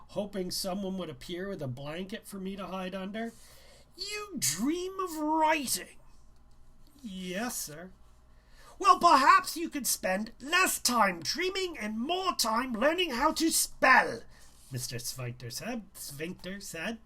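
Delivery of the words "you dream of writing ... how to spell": high, loud and haughty schoolmaster; condescending teacher lecturing a student; pompous teacher. A narrator's reading. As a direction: talk down to the boy as a smug teacher. Narrator voices pompous teacher.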